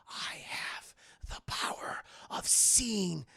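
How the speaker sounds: breathy whisper, slow and secretive; whispered boast. Acted. Hero tells the villain his secret power, whispering.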